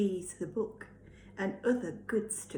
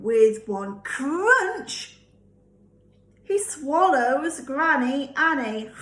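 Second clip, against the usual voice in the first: louder, slow and dramatic; dramatic slow crunch; 4 semitones higher, 7 dB louder, slower than usual. A narrator's reading. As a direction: hit the crunch hard and slow. Dramatic swallowing moment.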